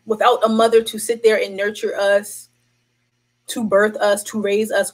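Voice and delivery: usual speaking voice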